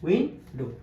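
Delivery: উইন্ডো split into উইন and ডো; two separate beats on উইন্ডো; syllable by syllable. Shown as a demonstration. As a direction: leave a gap inside উইন্ডো. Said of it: "ভাগ ভাগ করে উচ্চারণ".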